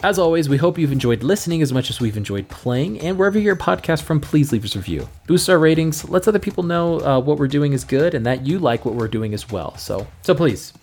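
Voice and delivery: cheerful plug read, quickening toward the email; podcast outro. Acted. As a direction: thank the listeners and pitch the review, brisk.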